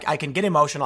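usual speaking voice

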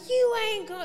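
her dad's voice pitched high and loud; cut-off mock fatherly warning; imitating her dad. Dad starting to forbid his teenage daughter, mock stern. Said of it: "this is how my dad would talk".

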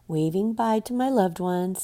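normal talking voice

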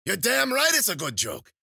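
very high, loud crowing; wild, screechy delighted crone; gloating witch. Acted. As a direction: shriek each boast with mounting glee. Witch revels in her joke.

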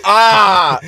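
loud high gotcha yelp; surprised shout. Real, not acted. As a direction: yell in gleeful shock. Mock shock at friend's confession.

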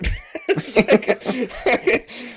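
high loud laughing burst; burst of laughter. Real, not acted.

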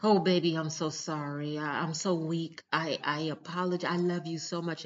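mock crying apology. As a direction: imitate a weepy cheater begging forgiveness. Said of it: "And then they go crying again".

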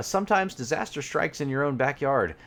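playful promo voice; ad read. Acted. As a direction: sell the pun like an insurance ad. Reading ad copy aloud.

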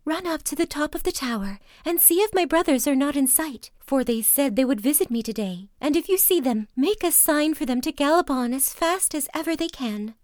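hurried, pleading orders; urgent wife. A narrator's reading.

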